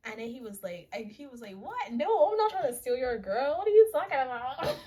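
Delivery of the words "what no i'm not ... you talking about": high, loud mock protest; hammed-up innocent outrage; indignant denial. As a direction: act falsely accused, voice jumping up. Acts Art's indignant denial.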